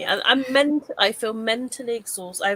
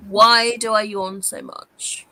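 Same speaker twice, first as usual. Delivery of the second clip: slow, louder dictated question; voice search query; 6 dB louder, slower than usual. Real, not acted. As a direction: dictate it slowly and firmly to a voice assistant. Repeats the query to voice assistant.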